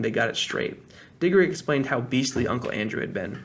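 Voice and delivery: ordinary delivery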